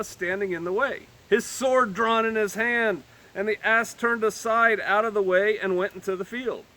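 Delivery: measured, lifted Bible reading; solemn King James reading; scripture reading. Acted. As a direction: read the verses with gravity. Reads scripture aloud.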